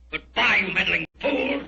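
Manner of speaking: triumphant villain yelling goodbye; villain's parting shout. Acted. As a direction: shout it down at the trapped men. Villain traps them and leaves.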